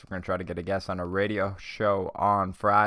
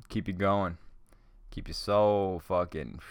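ordinary talk, nothing marked

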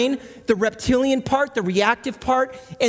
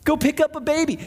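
normal talking voice

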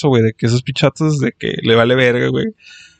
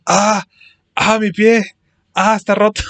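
hammed-up pain; 7 semitones higher, slower than usual. Classmate fakes a broken foot in silent class, hammed-up high moans. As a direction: ham up a fake broken foot, whining high.

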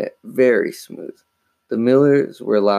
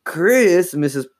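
sharply raised, high-pitched scolding; mother's high, raised cry; shouted; 9 semitones higher, 3 dB louder, slower than usual. A narrator's reading. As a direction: call the name out in alarm. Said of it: "Missus Paulwell shouted".

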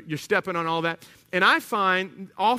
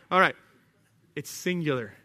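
usual speaking voice

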